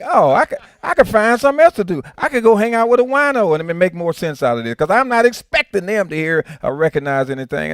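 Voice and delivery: mock-dismissive tirade; sarcastic brush-off. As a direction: shrug them off and walk away in mock scorn. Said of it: "it'd be like".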